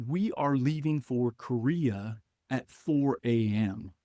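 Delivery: slow, measured announcement; deliberate announcement. Retold or imitated. Commander telling the new Marine about Korea, deliberate announcement. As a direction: space it out word by word, imitating an official order.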